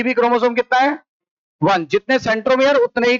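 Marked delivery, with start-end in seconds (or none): none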